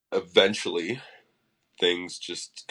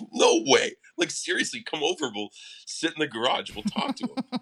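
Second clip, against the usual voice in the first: much higher, laughing 'no way', then excited invitation; laughing disbelief; 8 semitones higher than usual. Retold or imitated. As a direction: relive the giddy shock of the call. Telling bandmates [name] called.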